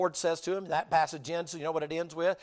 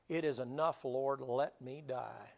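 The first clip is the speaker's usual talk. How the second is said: soft, slow, low weary plea; despairing prayer; 5 semitones lower, 8 dB quieter, slower than usual. Acted. Preacher voices Elijah praying under the tree, soft and slow. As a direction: ask to die, worn out. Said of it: "he begins to weep and cry".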